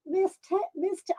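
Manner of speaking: usual speaking voice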